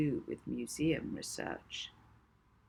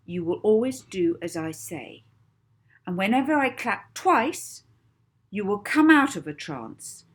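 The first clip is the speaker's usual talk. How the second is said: commanding hypnotist; 5 semitones higher, 6 dB louder than usual. A narrator's reading. Molly setting trance rules for cook.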